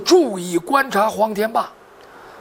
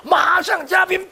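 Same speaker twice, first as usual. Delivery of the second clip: ordinary delivery, nothing marked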